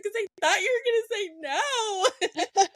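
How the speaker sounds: high, teary voice half laughing through tears; laughing-crying, overwhelmed with relief; crying retold. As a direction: relive the happy tears as she tells it. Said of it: "And I started crying".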